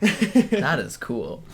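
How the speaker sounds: slow, drawn out and laughing. Real, not acted. Friend teasing her tiredness, slow laughing delivery. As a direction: laugh through it, stretching each word. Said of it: "Told you were tired".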